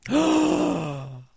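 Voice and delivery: sharp high gasp; shocked gasp. Real, not acted.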